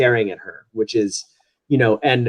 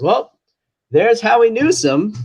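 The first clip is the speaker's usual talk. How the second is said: lightly voiced actor improvising, higher; covering actor onstage; 9 semitones higher, 3 dB louder than usual. Actor onstage stalling for the late Howie, mildly higher. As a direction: play it a little higher, vamping for time. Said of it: "he's looking down the bomb and goes".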